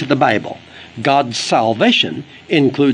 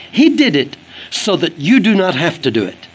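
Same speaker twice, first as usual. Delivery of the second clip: ordinary delivery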